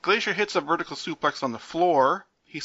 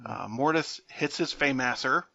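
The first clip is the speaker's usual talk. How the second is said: usual speaking voice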